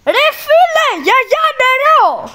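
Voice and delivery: very high, loud yell. Real, not acted. Shouting to grandma in another room for water, a very high loud yell. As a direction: holler across the house for water.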